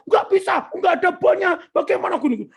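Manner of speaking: high, heated retold protest; angry refusal. Son refuses supplier's claim without a receipt, angry. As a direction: protest hotly, re-enacting the argument. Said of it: "marah-marah".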